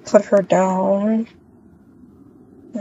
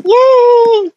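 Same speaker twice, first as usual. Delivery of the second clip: high, loud happy yay; a bright, loud burst of joy; excited cheer; 5 dB louder than usual. Real, not acted. Cheering at the shopping plan.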